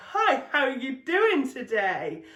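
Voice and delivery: drawn-out cheery pitch with a laugh; chirpy cold caller. Retold or imitated. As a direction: sing it out like a fake-bright salesman. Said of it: "And they go".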